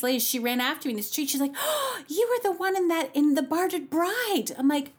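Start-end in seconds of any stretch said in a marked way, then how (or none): 1.6-4.5 high-pitched excited fan, drawn out at the end; starstruck excitement. Retold or imitated. Florist recognizing the opera singer, retold starstruck excitement. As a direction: imitate someone thrilled to spot a star.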